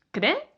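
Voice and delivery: creer partido en "cre", pausa, "er"; separado en sílabas. Shown as a demonstration. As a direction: di creer como dos sílabas sueltas, cre-er. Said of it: "decimos creer". (creer silabeado, con hiato marcado)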